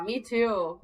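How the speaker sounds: usual speaking voice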